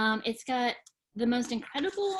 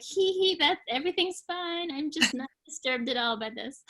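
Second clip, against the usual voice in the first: nervous giggle; 6 semitones higher than usual. Pretend. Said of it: "That nervous laughter here".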